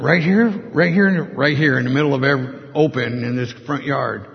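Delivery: retold incredulous reaction. Man asked to pray in a front yard, incredulous. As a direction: act out being taken aback, stumbling over the repeats. Acted disbelief at invitation to pray.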